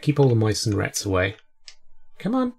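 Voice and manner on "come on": high rising coo, Come on. Real, not acted. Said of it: "I'm literally baby talking".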